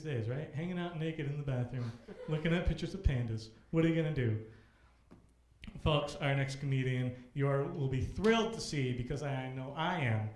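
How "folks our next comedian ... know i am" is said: loud announcer build to the name; MC introduction. Acted. Host welcoming the next comedian to the stage, loud announcer voice. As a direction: hype the next act, peak on the name.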